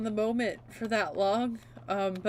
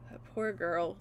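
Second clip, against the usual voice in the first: sighing softly; 3 semitones lower, 9 dB quieter than usual. Real, not acted. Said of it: "grieving for Ren".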